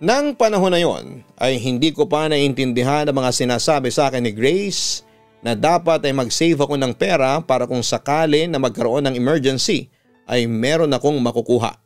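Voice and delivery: usual speaking voice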